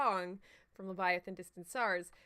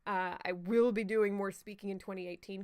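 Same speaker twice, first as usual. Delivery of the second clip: normal talking voice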